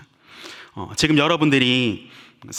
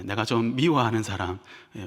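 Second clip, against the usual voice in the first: ordinary talk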